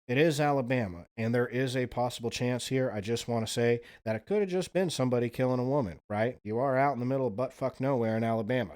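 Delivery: ordinary talk, nothing marked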